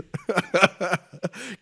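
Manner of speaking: high, loud burst of laughter; big unguarded laugh; hearty laugh. Real, not acted. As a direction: laugh out loud at your own confession. Laughs at his own confession.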